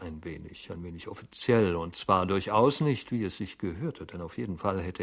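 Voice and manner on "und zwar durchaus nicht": ordinary delivery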